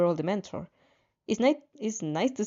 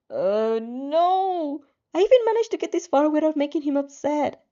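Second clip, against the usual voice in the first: inner dismay; 6 semitones higher, 3 dB louder than usual. A narrator's reading. Inner panic at lost points.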